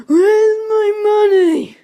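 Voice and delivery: put-on high, loud, slow old man's cry; old man shouting. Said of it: "in my old man voice, shouting".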